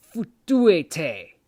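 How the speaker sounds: futuete barked loud; angry curse command. Shown as a demonstration. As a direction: fling futuete at them loudly. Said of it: "Or you would say".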